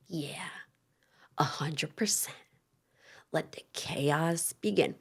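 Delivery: whispered, slow and scheming; sinister whisper. Retold or imitated. Jesse in his confessional, a mock sinister whisper. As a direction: breathe it out like a villain plotting.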